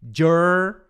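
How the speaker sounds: "jur-" held long on the English r; isolated, drawn-out "jur-"; syllable demonstration. Shown as a demonstration. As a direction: model "jur-" slowly, alone. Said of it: "é tipo assim".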